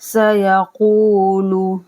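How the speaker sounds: very slow drawn-out Quranic recitation; recited at a crawl. Shown as a demonstration. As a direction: stretch each syllable as in tilawat. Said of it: "सीन को पढ़ेंगे तो सीटी की आवाज़ आनी चाहिए".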